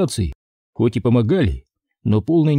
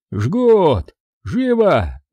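yelling; 6 semitones higher, much slower than usual. Acted. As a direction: shout the order over the noise. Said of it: "орал док".

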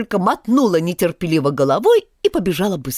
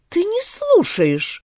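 higher, slow stern reproach; a stern mouse scolding Alice; 12 semitones higher, slower than usual. Acted. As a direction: scold her sternly, pitched high. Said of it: "строго сказала Алисе мышь".

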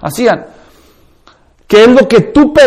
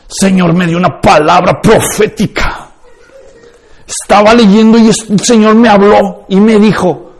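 mock charismatic prophecy. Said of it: "versión carismática".

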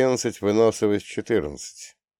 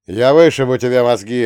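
shouted threat; 3 semitones higher, 8 dB louder than usual. Acted. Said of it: "орёт он".

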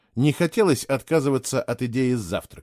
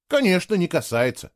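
higher, mildly scornful retort; huffy; 7 semitones higher than usual. A narrator's reading. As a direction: huff it back at the other person. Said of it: "фыркнул он". (слегка фыркая, с обидой)

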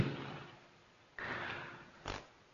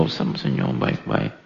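whispered aside; 8 semitones lower, 7 dB quieter, slower than usual. Retold or imitated. Bisikan sampingan.

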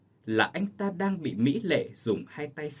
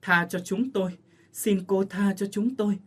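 high, frantic begging; 7 semitones higher than usual. Acted. Sisters fleeing the ghost, high frantic begging. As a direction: plead for mercy while fleeing. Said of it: "vừa chạy vừa van lơn".

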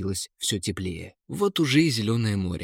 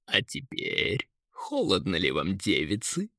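low taunting eagle; mocking; 4 semitones lower, 4 dB quieter than usual. A narrator's reading. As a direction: jeer at them in a lowered bird voice. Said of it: "издевался орёл". (издевательски, низким голосом)

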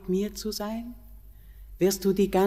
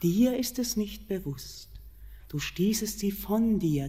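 normal talking voice

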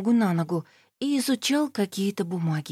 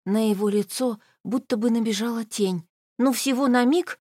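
plain reading voice

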